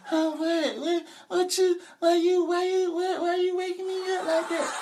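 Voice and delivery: high mock sleepy girlfriend; groggy girlfriend. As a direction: play her dazed and whiny. Girlfriend just woken, confused.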